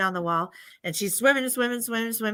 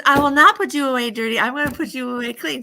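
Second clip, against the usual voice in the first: put-on loud, raised, resolute little girl; firm refusal; 3 semitones higher, 5 dB louder, faster than usual. Good girl refuses to leave dishes dirty, loud and firm. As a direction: refuse loudly with voice lifted.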